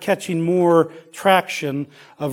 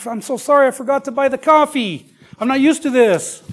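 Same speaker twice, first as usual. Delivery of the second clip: higher, flustered hostess voice; apologetic cousin; 9 semitones higher, 4 dB louder than usual. Retold or imitated. Cousin apologizing to her guest, higher and flustered. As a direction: play the embarrassed cousin who forgot the coffee. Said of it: "she goes".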